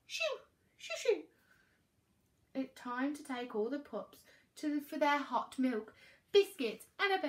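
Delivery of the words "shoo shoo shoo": very high, quick little shoos; shooing. A narrator's reading. Mum sending Peaches and Dad away, shooing. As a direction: flick them away in a squeaky high voice.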